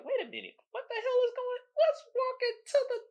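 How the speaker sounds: high, puzzled mock voice; imitating a curious bystander. Mocking someone who walks toward danger, high and puzzled. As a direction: play the clueless guy wandering in. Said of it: "we don't be like".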